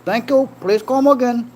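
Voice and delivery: put-on high-pitched caricature Indian accent; mock Indian accent. Streamer jokes he is from India, mock Indian accent. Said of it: "I am from India".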